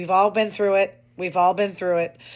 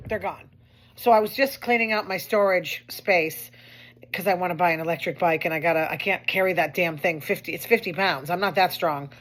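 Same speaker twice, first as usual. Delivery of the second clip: ordinary talk, nothing marked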